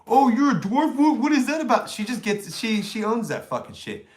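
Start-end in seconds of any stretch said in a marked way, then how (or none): 0.1-1.9 high mock surprise, lightly voiced. Streamer imitating a rude asker, mildly high. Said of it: "it's not like".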